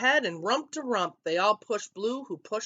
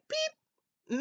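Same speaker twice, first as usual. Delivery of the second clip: tiny, very high peep; chick peep; 4 dB quieter than usual. A narrator's reading. Chick greets Blue, tiny high peep. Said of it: "and her chick said".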